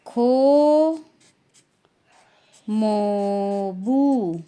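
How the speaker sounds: slow, clear Korean "खो मोबू", drawn out syllable by syllable; teacher modelling Korean "खो मोबू"; Korean term. Shown as a demonstration. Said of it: "तो उनको बोलते हैं".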